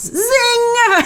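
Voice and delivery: high, punchy cry of delight; cheering the comeback; gleeful zing. Real, not acted. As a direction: call it like a zinger landed.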